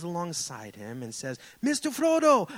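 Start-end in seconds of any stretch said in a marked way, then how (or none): 1.6-2.5 high, loud attempt at a Samwise accent; a preacher half-failing a Samwise accent. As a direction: call out to Frodo in a rough try at Sam's accent. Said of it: "I can't do the accent".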